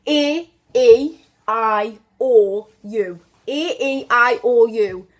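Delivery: loud, separated A, E, I, O, U; Geordie vowels. Shown as a demonstration. Teacher showing sounds you need right, loud Geordie A, E, I, O, U. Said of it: "really strong, um, Geordie, um, sounds".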